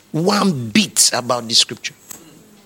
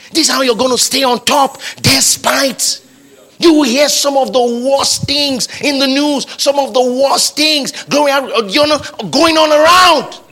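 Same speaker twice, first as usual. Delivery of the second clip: high, insistent sermon delivery; emphatic preaching; 9 semitones higher, 5 dB louder than usual. Real, not acted. Preacher telling congregation of bad news, emphatic preaching. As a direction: press each phrase on the congregation, pitched up.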